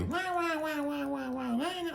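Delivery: high whiny mock nagging babble; mock whining wah wah. Mocking the annoying girlfriend's nagging as a high whine. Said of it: "she's just annoying me".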